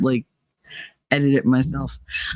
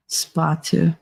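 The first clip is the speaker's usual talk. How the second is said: ordinary talk, nothing marked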